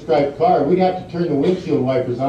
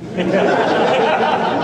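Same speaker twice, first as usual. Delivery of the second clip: real laugh breaking out high; high burst of laughter; 8 semitones higher than usual. Real, not acted. Telling the prank story to the interviewer, real high laughter.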